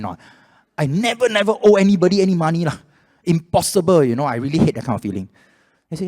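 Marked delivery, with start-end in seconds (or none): 0.8-4.0 high, loud protest of innocence; indignant. Retold or imitated. Man retelling his denial of owing money, indignant. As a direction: hammer out the outraged denial.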